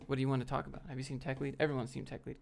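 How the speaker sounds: usual speaking voice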